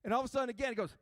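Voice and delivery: usual speaking voice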